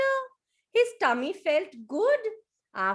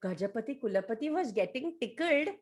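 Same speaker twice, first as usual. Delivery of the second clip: usual speaking voice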